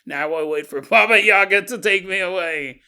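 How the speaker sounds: lightly spooky, ominous grandson voice; mock spooky line. Grandson alone in the dark RV, mock spooky. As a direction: play it as a half-joking doom. Robbie waiting in darkness, acted.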